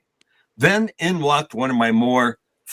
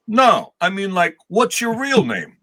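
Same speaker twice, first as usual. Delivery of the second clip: higher, slow, mildly irritated repeat; a little agitated; 8 semitones higher, slower than usual. Retold or imitated. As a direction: press the question with mild impatience. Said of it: "A little agitated".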